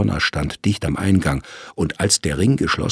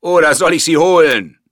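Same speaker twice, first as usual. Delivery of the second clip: much higher, loud booming command; 13 semitones higher, 7 dB louder than usual. A narrator's reading. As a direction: let it roar through the vault. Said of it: "dröhnte die Stimme".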